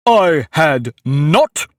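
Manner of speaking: loud, drawn-out indignant denial; sharp, very angry. A narrator's reading. Mouse contradicts Alice, sharply and very angrily. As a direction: deny it angrily, leaning hard on each word. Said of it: "sharply and very angrily".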